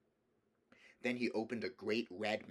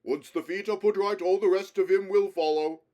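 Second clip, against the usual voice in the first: high, much louder joyful call; joyous shout; 10 semitones higher, 13 dB louder than usual. A narrator's reading. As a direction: call out gladly across the courtyard. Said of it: "shouted Aslan joyously".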